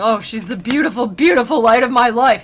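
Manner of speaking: loud, hammed-up swooning; mock rapture over a crush; lovesick nerd. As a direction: gush like an infatuated fanboy. Dante gushing over Beatrice, acted.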